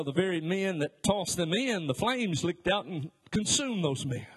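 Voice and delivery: normal talking voice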